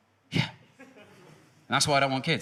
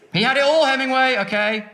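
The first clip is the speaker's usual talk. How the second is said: loud rant; 8 semitones higher, 7 dB louder than usual. Real, not acted. Ranting about Hemingway.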